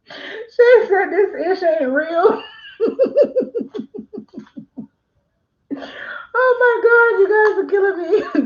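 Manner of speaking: laughing high, almost crying; cracking up at the chat; laughing hard. Real, not acted. Notary reading funny chat comments, laughing hard.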